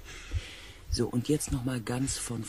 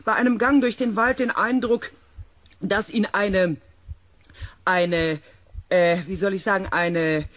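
loud radio talk; 7 semitones higher, 6 dB louder than usual. Acted. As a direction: speak up over a bad radio line. Shouting over a radio link.

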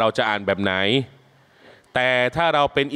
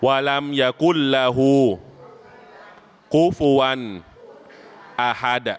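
melodic Quran recitation. Shown as a demonstration. Imam leading students in reciting surah al-Ikhlas, melodic chant. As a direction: chant each verse with a sung, stretched line.

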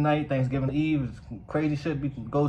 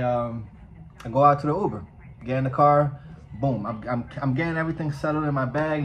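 ordinary delivery

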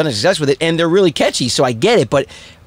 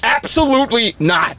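stern dad; 6 semitones higher, slower than usual. Retold or imitated. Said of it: "He's like".

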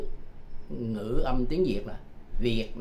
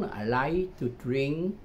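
slow demo; much slower than usual. Shown as a demonstration. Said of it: "model sentence".

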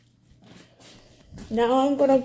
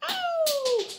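sharp yelp of pain; 5 dB quieter than usual. Real, not acted. Sudden pain while working.

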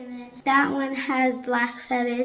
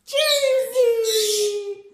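high, loud happy call of a pet's name; excited call; 8 semitones higher, 5 dB louder than usual. Real, not acted. Owner greeting her parrot, excited call. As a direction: call out to the bird with delight.

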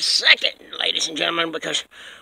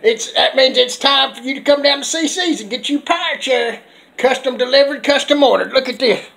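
high, loud hard-sell voice; loud sales pitch; 5 semitones higher, 5 dB louder than usual. Acted. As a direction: shout it like a TV ad hawking chairs. Pitches his store like an ad.

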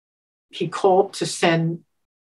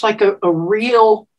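ordinary talk

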